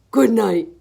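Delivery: loud gorilla goodnight; short, loud ape goodnight. A narrator's reading. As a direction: say it big, as a gorilla. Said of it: "He says".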